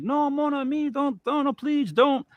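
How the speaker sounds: very high-pitched, panicky French joke voice; pleading Frenchman. Acted. French guy begs cannibals in a joke, high mock pleading. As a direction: beg in a squeaky mock-French falsetto. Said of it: "cannibals go up to the French guy".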